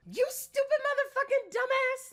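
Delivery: very high, loud shrieked insult; screaming insult. Retold or imitated. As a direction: shriek it like a furious rant. Her angry video heard by Curtis.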